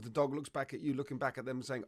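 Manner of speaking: ordinary talk, nothing marked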